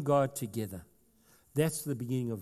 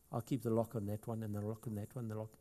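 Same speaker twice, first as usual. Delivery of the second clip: guarded reluctance; 4 semitones lower, 7 dB quieter, faster than usual. Retold or imitated. Voicing someone hoarding locked rooms.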